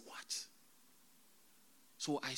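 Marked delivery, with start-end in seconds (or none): 0.1-0.5 hushed, whispered disbelief; retold alarmed whisper. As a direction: whisper it under your breath, aghast. Alarmed reaction in retold vision.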